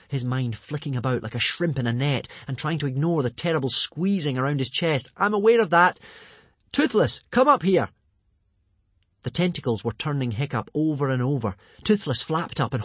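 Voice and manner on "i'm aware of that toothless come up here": high, loud impatient shout; urgent calling. A narrator's reading. Hiccup trapped in tentacles summons Toothless, urgent high call. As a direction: call the dragon up at once.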